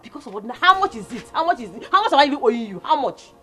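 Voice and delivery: indignant demand. Acted. Quarrel over debt.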